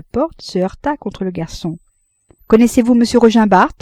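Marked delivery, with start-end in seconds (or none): 2.5-3.7 urgent question. Acted. Frédéric urgently questions a waiter.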